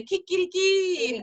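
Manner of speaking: rooster crow imitation. Shown as a demonstration. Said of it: "roosters don't say cock-a-doodle-doo".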